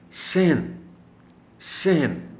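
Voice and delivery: صحن slow, ح silent like رحم; correct pronunciation. Shown as a demonstration. Teacher repeats correct صحن slowly. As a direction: stretch صحن, sehn. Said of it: "ہے پر جزم ہے".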